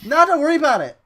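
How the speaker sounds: loud, sarcastic reassurance; loud mock-aggressive. Real, not acted. Ribbing a friend, loud mock-aggressive. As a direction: bark it sarcastically. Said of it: "That was so aggressive".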